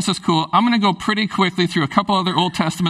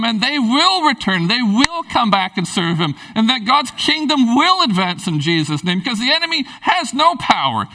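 impassioned, high-pitched rallying call; fired-up, emphatic exhortation; excited preaching; 5 semitones higher than usual. Real, not acted. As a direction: urge the congregation on with rising fervor. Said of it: "that gets me excited".

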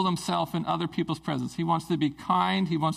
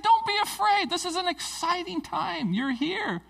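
high-pitched, eager welcome; acted-out joyful exclamation; excited reassurance; 13 semitones higher than usual. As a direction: greet them with thrilled excitement. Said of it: "He's excited about it".

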